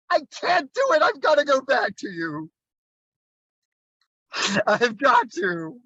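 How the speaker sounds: hammed-up, high lovesick outcry; mock desperate plea. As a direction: plead melodramatically, unable to part. Lady refuses to part from lover.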